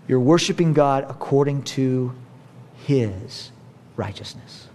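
slow, fading to a hush on "righteousness"; stress on righteousness. Real, not acted. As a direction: drop to near silence on "his righteousness". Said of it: "get this".

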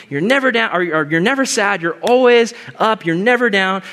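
lightly hammed-up sunny enthusiasm; mock peppy. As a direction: play the over-the-top happy believer.

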